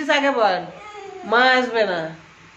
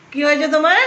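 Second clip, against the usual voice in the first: ordinary talk, nothing marked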